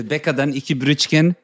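mock Turkish-German bakery order, Brötchen as brʏtʃkən; imitating mom ordering two rolls; Turkish accent. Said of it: "Sagt die immer".

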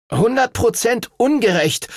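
high, indignant whinge; bleating complaint. A narrator's reading. Arthur protesting to the master, bleating complaint. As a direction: grumble loudly at the injustice. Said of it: "meckerte Arthur".

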